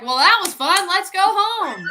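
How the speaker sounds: high, loud, laughing dad voice; breezy dad. Retold or imitated. Dad picks daughter up from college, laughing breezily. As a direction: chuckle it off casually. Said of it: "and was like".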